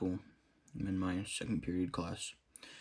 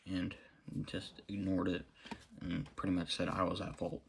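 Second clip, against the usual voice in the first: ordinary talk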